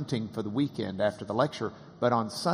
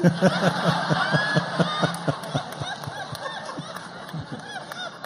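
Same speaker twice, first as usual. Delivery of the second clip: big laugh. Real, not acted. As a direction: crack up at your own punchline. Punchline lands, everyone laughs.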